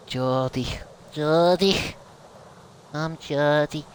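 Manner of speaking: put-on a light Geordie accent, Newcastle vowels. Voicing a Spyro dragon in a Geordie accent. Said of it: "I want a Geordie dragon".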